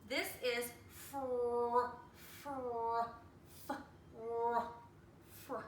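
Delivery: fr stretched slowly; slow blend. Shown as a demonstration. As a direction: pull fr apart into f and r. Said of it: "That's a blend".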